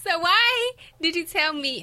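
high, loud playful protest; mock-exasperated demand. Real, not acted. Daughter quizzing her mother about advice, mock-exasperated. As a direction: demand an answer with amused exasperation.

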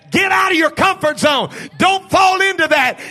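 warning prophet's voice. Acted. The man's voice warning the people, loud urgent shout. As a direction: cry out like a man sounding the alarm. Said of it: "the voice of a man that's saying".

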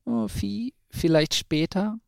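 high, hesitant little owl; nervous stammer. A narrator's reading. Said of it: "stotterte sie".

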